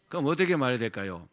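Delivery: ordinary delivery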